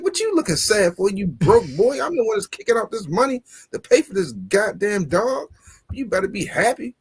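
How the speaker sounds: put-on fussing at a moping kid; half-joking dad telling off his broke son; mock scolding.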